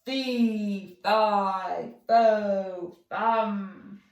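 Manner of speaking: loud booming giant chant. Acted. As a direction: boom each word like a huge giant. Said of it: "A loud booming voice called out".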